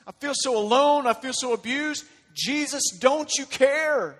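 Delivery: loud, high-pitched anguished pleading; desperate cry to Jesus. Acted. Hurting believer calling on a sleeping Jesus, desperate cry. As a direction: cry out each trouble forcefully, building an urgent appeal.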